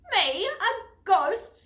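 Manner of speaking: high, loud offended scoff; indignant snort. A narrator's reading. Offended mouse snorts at the children, indignant. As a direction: scoff as if insulted. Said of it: "snorted an indignant voice".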